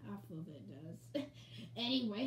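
ordinary talk

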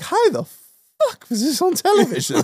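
high incredulous outburst; mock shocked viewer; disbelief. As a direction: act amazed at what aired. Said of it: "you're like".

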